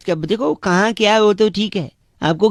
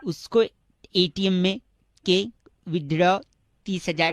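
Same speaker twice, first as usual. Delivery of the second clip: broken into chunks with long pauses, softer; halting speech; 4 semitones lower, 7 dB quieter than usual. Acted. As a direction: fake a breaking phone line. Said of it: "फिर अटक अटक के बोले".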